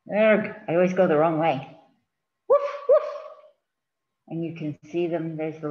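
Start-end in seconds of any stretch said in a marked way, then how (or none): 2.5-3.0 very high yappy puppy bark; puppy woof. Acted. Reading to a child, high puppy woof. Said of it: "my woof is a little too high-pitched".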